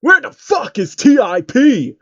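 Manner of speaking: high, loud, slow mock-angry rapper yell; hammed-up angry call from the doorway. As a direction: demand to know where he is. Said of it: "a voice called out from the main entrance".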